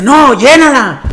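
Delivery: mock bossy bishop; firm order. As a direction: demand it, drawn out. Bishop insisting on a full tank.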